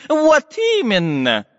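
high, slow, emphatic professor confirming; excited. Acted. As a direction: burst out with a high excited yes. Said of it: "взволнованно подтвердил".

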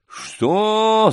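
high, outraged roar. Acted. Гундосый to Сашка who refused the anthem, roaring. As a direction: bellow it in disbelief. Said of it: "заревел Гундосый".

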